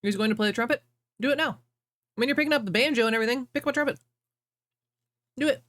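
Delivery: talking with a mouth full of popcorn; munching popcorn. Real, not acted. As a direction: chew popcorn while talking. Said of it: "I'm eating popcorn now".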